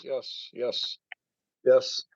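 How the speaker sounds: ordinary talk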